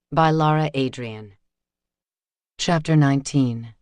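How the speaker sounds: plain reading voice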